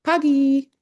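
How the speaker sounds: Pagi sung out high and bright, both syllables clear; Indonesian word said high. Shown as a demonstration. Teacher models Pagi for travelers in a high voice. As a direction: lift Pagi up high like a greeting. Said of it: "pagi is P A G I".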